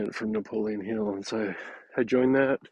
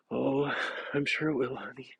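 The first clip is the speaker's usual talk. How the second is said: teasing, higher sweet tone; gently mocking; 4 semitones higher than usual. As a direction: humor him with playful mock. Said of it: "Part of that's a little mocking".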